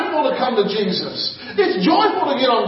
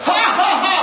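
higher, deliberate forced ha ha ha; forced laugh; 8 semitones higher, 3 dB louder than usual. Retold or imitated. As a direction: laugh on purpose without feeling it. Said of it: "He didn't feel like laughing, but he went".

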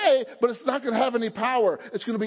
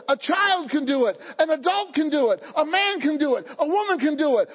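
ordinary talk

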